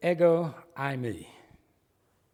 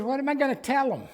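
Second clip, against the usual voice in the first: higher, fast fretful Moses voice; worried Moses; 9 semitones higher, 4 dB louder, faster than usual. Retold or imitated. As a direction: play Moses fumbling for an excuse, a little higher. Moses voiced making excuses to God.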